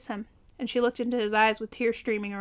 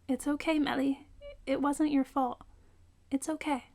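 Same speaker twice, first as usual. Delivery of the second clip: comforting; 4 semitones higher than usual. A narrator's reading. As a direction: gently reassure her it's not her fault. Rose comforts Melly.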